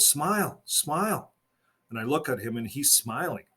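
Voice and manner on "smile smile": mild narrated yell. A narrator's reading. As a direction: call it out high without really yelling. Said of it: "then the angel yells out".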